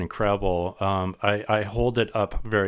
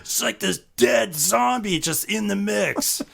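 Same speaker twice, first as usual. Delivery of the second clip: much higher, eager bandmate voice; excited discovery; 11 semitones higher than usual. Retold or imitated. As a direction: realize it with delight. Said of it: "And we're like".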